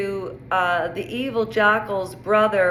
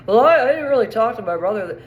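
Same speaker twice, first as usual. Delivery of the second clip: put-on fast, loud New York accent for the brother; quick-fire New York brother quoting himself; 4 dB louder, much faster than usual. Brother brushing off the host, in a fast New York accent. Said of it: "he has a New York accent".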